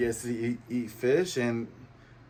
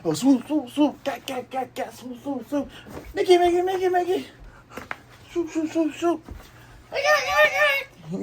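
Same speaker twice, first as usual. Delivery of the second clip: very high squeaky chant rising to a shout; cartoon fight chatter. Buggy flailing back at Luffy, squeaky noises building to a yell. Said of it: "Buggy's like".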